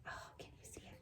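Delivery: hushed whisper for something small; soft whispered wonder; whispering tiny. Real, not acted.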